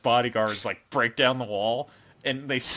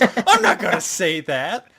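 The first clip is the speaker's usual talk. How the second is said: louder, higher, slow protest with laughter near; mock indignant refusal; 6 semitones higher, 6 dB louder, slower than usual. He refuses the girl's cue card, mock indignant. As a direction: balk loudly and slowly, hammed up.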